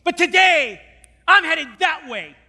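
convert's loud vow; ringing declaration. Retold or imitated. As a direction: proclaim his new path out loud. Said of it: "and he said".

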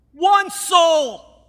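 full-voiced pulpit cry; shout. Real, not acted. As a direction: roar "One soul!" with "One" hit hardest. Preacher's climax shout.

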